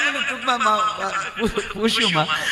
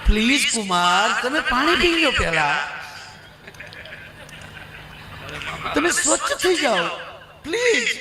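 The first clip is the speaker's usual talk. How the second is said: pleading mother-in-law; 4 semitones higher than usual. Acted. Mother-in-law soothes son-in-law.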